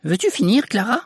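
high-pitched, sharp scolding; scolding mother. A narrator's reading. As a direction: scold the children over your shoulder. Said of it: "qui grondait les enfants".

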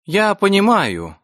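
high, slow preachy auntie; drawn-out knowing sigh of a matron. A narrator's reading. Matron resumes her lecture.

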